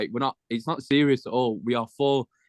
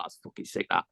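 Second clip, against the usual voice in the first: whispered; 5 semitones lower, 7 dB quieter than usual. Retold or imitated. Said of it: "it's like".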